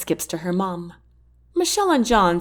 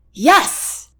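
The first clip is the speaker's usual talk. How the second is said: high, loud burst of joy; joyful cry; 8 semitones higher, 5 dB louder than usual. A narrator's reading. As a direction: shout it out, overjoyed. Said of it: "Jack cries".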